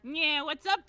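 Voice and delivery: mock wisecracking toon hero. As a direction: put on a big cartoon voice. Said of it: "like, toon voice for all the lines".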